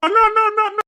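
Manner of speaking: hammed-up desperate sobbing; crying for help. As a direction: bawl out for your brother. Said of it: "எதுக்கு அழுகுற".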